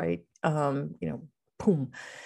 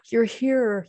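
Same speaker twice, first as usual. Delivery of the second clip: gasp, then high, louder delight; excited kid; 7 semitones higher, 4 dB louder than usual. Retold or imitated. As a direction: imitate a thrilled kid with a sharp gasp. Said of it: "he was so excited".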